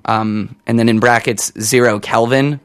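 ordinary talk, nothing marked

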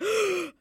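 high, loud shocked gasp; mock gasp. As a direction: gasp in amazement. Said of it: "no, that's gasping".

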